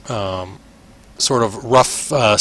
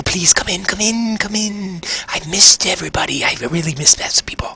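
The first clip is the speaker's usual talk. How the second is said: high, eager creepy voice that drifts into a whisper; hungry voice in his head; 8 semitones higher than usual. Acted. Voice in the rogue's head begging him for food. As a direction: coax him inward like a starving thing. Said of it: "you start to hear this voice where it's like".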